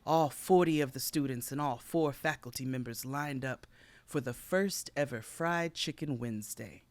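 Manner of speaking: ordinary delivery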